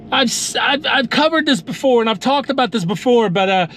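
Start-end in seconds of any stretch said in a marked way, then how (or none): none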